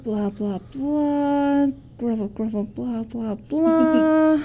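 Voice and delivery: bored captain skimming a letter; dismissive skimming. Acted. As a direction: drone through it, stretching each final blah.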